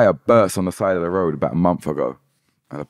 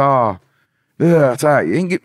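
garage man's voice; 7 semitones higher, 6 dB louder than usual. Retold or imitated.

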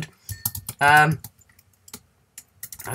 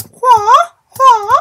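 high, loud fake crying wah; loud hammed-up crying wah; mock wail; 12 dB louder than usual. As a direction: wail like a cartoon baby.

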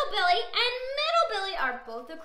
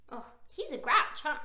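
ordinary delivery, nothing marked

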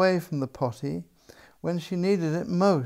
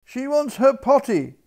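much higher, loud cook's cry; 9 semitones higher, 6 dB louder than usual. A narrator's reading. Cook shrieking the alarm to the household. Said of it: "cried the cook".

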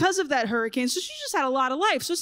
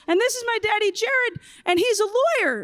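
high, sing-song preschooler introduction; little kid voice; 8 semitones higher than usual. Retold or imitated. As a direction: mimic a small boy proudly presenting his dad. Preschooler introduces his dad.

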